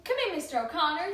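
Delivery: ordinary talk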